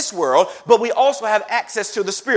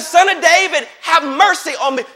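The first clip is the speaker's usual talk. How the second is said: blind beggar's plea; 6 semitones higher than usual. Acted. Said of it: "He said".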